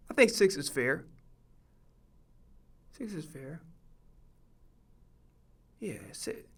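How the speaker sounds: very soft, low murmur; quietly confirming to oneself; thinking aloud. Real, not acted. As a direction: mull it over under your breath. Musing over his ranking alone.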